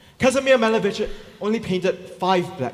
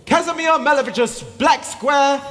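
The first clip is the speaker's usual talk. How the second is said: fish market auctioneer; 7 semitones higher, 7 dB louder than usual. Acted. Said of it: "announcing the sale".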